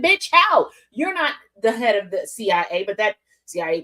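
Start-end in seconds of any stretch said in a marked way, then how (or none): 0.0-0.7 loud, sharp, high challenge; incredulous retort. Real, not acted. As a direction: call out the nonsense. Outraged retort.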